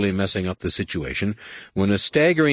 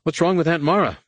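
high, louder frantic question; desperate to know if she lives; panicked; 9 semitones higher, 3 dB louder than usual. Acted. Anakin frantically asks the crowd about his aunt.

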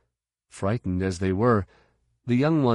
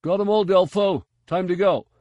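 loud, high, muffled shout from afar; muffled call; 11 semitones higher, 5 dB louder than usual. Acted. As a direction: call down the hall through a muffle. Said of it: "A muffled voice called down the corridor".

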